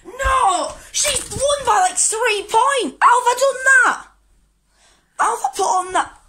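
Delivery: high, loud disbelieving shouts; outraged shouting. Real, not acted. Losing the weigh-in.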